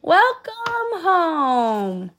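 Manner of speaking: high, very slow, drawn-out welcome; warm welcome. Acted. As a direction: stretch out a joyful welcome. Villagers greeting warmly.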